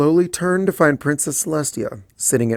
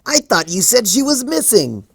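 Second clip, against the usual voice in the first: surprised; 11 semitones higher, 4 dB louder than usual. Acted. Dash startled, voiced higher.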